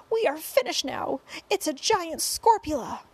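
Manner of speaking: high-pitched wail of doom; panicked yell. A narrator's reading. Head of fire department despairs at giant spider, panicked yell. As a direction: cry out that all is lost. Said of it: "yelled the head of the fire department".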